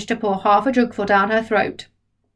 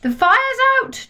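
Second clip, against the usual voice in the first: put-on much higher, proud boy; proud announcement. George proudly tells Grandma the fire's out. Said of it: "announced proudly".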